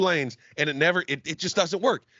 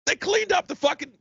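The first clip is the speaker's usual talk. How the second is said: outraged shout; 12 semitones higher than usual. Real, not acted. Ranting about the city.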